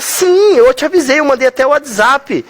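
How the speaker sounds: high-pitched, indignant insistence. Acted. Margot insisting to Val that she warned him, indignant. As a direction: protest in a mock-exasperated high voice. Acted quarrel over a late meeting.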